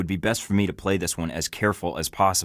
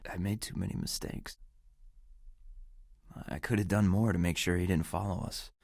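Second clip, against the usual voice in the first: quiet regret; 9 dB quieter than usual. Acted. Quiet self-blame.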